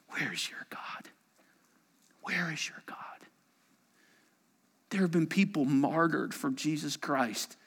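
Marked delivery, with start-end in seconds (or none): 0.1-3.0 hushed mocking question; whispered taunt. Mockers taunting the psalmist in a whisper. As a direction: let the taunt creep in.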